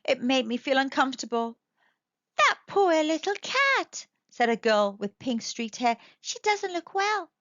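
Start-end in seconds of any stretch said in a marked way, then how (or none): 2.4-4.1 put-on high, slow little-girl voice; girl's voice. Girl in the crowd pitying the cat, high cooing. 6.2-7.3 put-on girl's voice. Girl in the crowd pitying the cat, high cooing.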